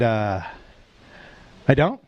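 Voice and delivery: low, hesitant disbelief; pause, then question it in a low voice; genuinely surprised. Retold or imitated. Driver answering the cop, low hesitant surprise. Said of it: "I was genuinely surprised".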